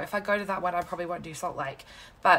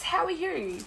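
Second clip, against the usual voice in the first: ordinary talk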